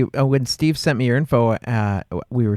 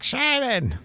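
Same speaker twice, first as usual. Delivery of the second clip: high, hollow, quieter call of "Shannon"; calling a name; 9 semitones higher, 5 dB quieter than usual. Retold or imitated. Nurse calling the patient in the waiting room. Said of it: "they're like".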